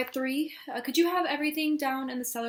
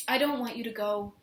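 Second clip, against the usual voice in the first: ordinary talk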